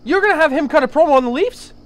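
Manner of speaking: loud, high, hammed-up outrage at a rival pick; mock outrage. Host mock-outraged at his guest's Leafs pick, loud and high. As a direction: fake fury, dragging out the words.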